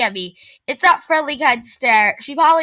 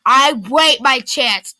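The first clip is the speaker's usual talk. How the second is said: plain reading voice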